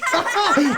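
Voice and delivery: high giggle at his own joke. Real, not acted.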